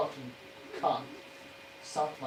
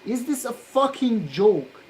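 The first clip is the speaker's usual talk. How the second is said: louder, higher furious yell; 7 semitones higher, 8 dB louder than usual. Real, not acted. Solderer warning he'll yell, then yelling. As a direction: explode at the board. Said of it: "I'm honestly going to yell at this point".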